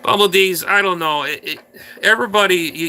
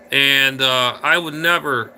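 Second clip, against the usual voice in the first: ordinary talk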